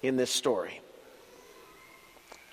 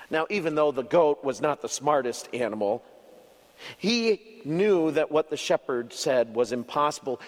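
ordinary delivery

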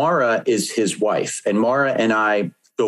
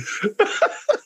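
laugh. Real, not acted. Laughs at the proposal.

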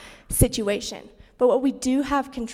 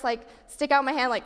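ordinary talk, nothing marked

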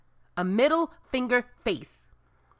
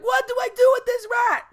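very high, loud panicked shout; panicked cry; 7 dB louder than usual. Retold or imitated. She shouts to the manager holding the moving rat, high panic. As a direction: beg for help in panic.

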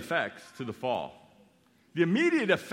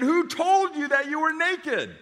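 higher, louder demanding question; a touch of stern God; 13 semitones higher, 7 dB louder than usual. Acted. God demands of Adam, higher and louder. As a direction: confront him, higher and louder.

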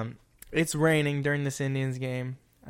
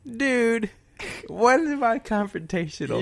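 indignant protest; 9 semitones higher, 3 dB louder than usual. Real, not acted.

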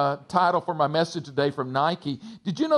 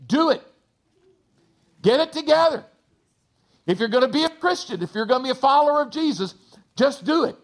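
higher, punchy no-nonsense voice; blunt James; 7 semitones higher, faster than usual. Retold or imitated. Pastor voicing James telling Christians to get it together, blunt. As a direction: lay it on the line like Mister Practical. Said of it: "the theme of the book is basically".

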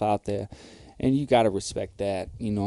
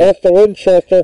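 loud high shouting into a cup; screaming into a glass; 7 semitones higher, 15 dB louder than usual. Pretend. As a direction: yell it into the glass like a mic. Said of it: "you're screaming into that glass".

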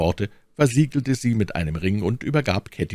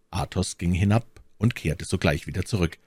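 usual speaking voice

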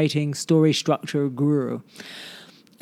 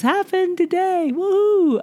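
excited whoop; 14 semitones higher, slower than usual. Real, not acted. Host telling listeners about her new house, excited whoop. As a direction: pitch shooting way up, gleeful cheer. Just signed to buy house.

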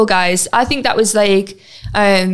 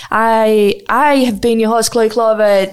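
ordinary delivery

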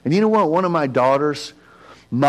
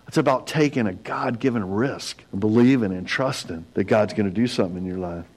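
normal talking voice

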